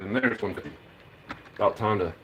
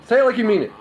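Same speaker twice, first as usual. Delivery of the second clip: louder, higher urging to the dog; 11 semitones higher, 11 dB louder, faster than usual. Real, not acted. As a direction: egg the dog on, loud and high.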